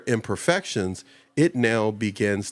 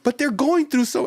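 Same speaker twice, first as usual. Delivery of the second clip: much higher, near-crying put-on plea; mock tearful plea; 14 semitones higher than usual. Host retelling pleading for guests, high mock tearful voice. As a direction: squeak it as if about to cry. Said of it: "I'll be ready to cry".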